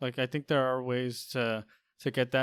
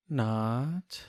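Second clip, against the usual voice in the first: seductive voice; 2 semitones lower than usual. Acted. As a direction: purr it like a charmer. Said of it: "Was that a seductive voice?".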